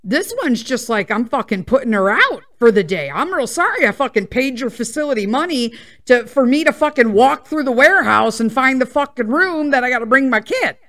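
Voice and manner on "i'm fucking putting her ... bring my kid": sarcastic rant. Retold or imitated. Mom fed up with the unhelpful receptionist, sarcastic and indignant. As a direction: rant in mock apology.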